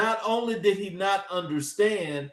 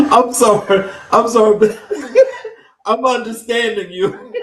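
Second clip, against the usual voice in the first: loud, higher, talking through real laughter; laughter breaking into the words; laughing apology; 6 semitones higher, 12 dB louder than usual. Real, not acted. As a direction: apologise while still cracking up. Apologises while cracking up.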